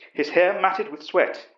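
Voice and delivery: plain reading voice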